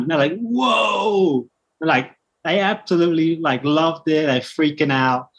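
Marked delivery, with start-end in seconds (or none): 0.4-1.5 long, high mock-amazed whoa; imitated awed cheer. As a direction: draw it out high, like an impressed group. Cyclists amazed at his feat.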